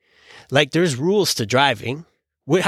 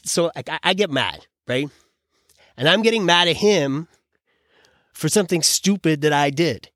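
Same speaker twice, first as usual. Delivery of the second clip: normal talking voice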